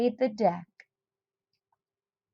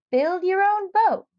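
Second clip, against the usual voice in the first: high, loud advert pitch; 10 semitones higher, 5 dB louder than usual. Acted. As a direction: sell it like a cheesy announcer. Newspaper ad read out.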